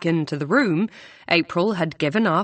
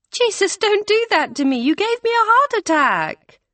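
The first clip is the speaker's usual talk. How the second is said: much higher, alarmed protest; startled scolding; 4 dB louder than usual. Acted. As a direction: react as if frightened half to death. Startled friend scolds after sudden entrance.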